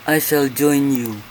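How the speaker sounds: ordinary talk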